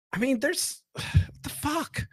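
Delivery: sighed, breathy whisper of disbelief; exasperated whisper. Real, not acted. To listeners, baffled by the forehead, a sighing whisper. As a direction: let it out on a sigh, barely voiced.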